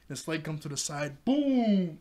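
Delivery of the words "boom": a loud, high boom like a hit landing; excited boom. Retold or imitated. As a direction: punch it out like a sound effect. Excited recap of a fight blow.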